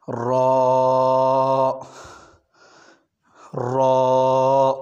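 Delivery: را said full and thick, with a rolled ر; heavy ra. Shown as a demonstration. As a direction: make را heavy and full-mouthed. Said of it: "را کو موٹا پڑھا جائے گا".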